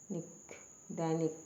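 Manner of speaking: ordinary delivery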